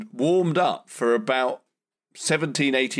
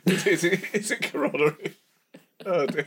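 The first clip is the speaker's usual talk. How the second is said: laughing; 7 semitones higher than usual. Real, not acted. Laughing at own mistake.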